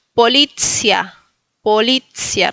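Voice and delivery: "polizia" slowly as po-LI-tsia. Shown as a demonstration. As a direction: model "polizia" with long LI and crisp ts. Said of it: "la C se pronuncia T Z". ("polizia" con sonido ts)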